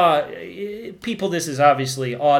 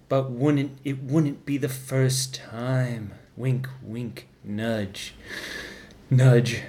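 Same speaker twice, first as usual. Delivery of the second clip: a hint of a sly, slow dwarf pleading his love; slow, low-key scheming uncle; lightly voiced Tyrion; 6 semitones lower, slower than usual. Tyrion begging Joffrey to choose him, lightly voiced and slow.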